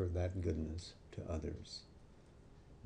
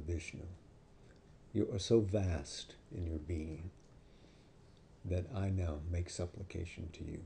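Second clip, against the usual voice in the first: softly, humbly bowing in words; a quiet, reverent confession to the boy; humble reverence; 4 semitones lower, 5 dB quieter than usual. Retold or imitated. Angry teacher humbles himself before boy.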